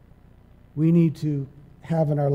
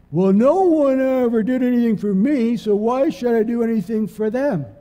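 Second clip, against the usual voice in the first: high, louder mocking whine; whiny selfish churchgoer; 5 semitones higher, 3 dB louder than usual. Preacher mocking a selfish churchgoer, whiny voice. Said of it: "they say something stupid like this".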